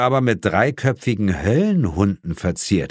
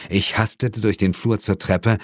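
usual speaking voice

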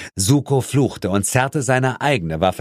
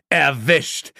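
raised, triumphant exclamation; 6 semitones higher than usual. A narrator's reading. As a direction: call it out in triumph. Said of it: "rief ich triumphierend". (triumphierend gerufen)

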